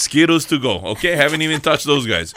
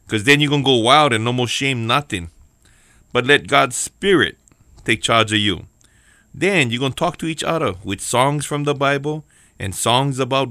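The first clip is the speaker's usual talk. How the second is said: Hawaiian Pidgin reading. Acted. Pastor reading Ephesians in Hawaiian Pidgin, lively Pidgin voice. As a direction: read it like a local uncle in Pidgin. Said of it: "let me put on my lips".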